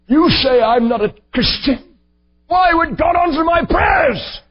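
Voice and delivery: high, loud indignant outburst as granny; shouting back in mock outrage; indignant retort. Granny scolding her grandson, indignant shout.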